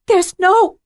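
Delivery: high, loud frightened plea cut off; high panicked protest breaking off; panicked cry. Acted. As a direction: she begs in terror. Terrified woman cut off.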